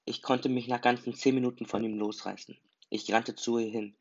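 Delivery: plain reading voice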